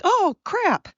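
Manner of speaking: high, mocking squeal of panic; mock the countries panicking like cowards; panicked mock whine. Mocking foreign countries caught out, high panicked whine. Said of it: "then they are like".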